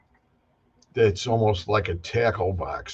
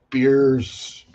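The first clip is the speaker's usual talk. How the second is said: "Beers" with a broad Chicago vowel; Chicago accent; 3 semitones higher than usual. Retold or imitated. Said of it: "weird Chicago accent".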